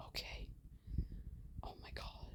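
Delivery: a shocked whisper to herself; stunned whisper. Real, not acted. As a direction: breathe it out, barely audible. Shocked reaction while reading messages.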